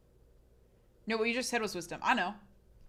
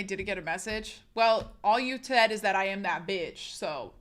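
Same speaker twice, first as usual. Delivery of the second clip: usual speaking voice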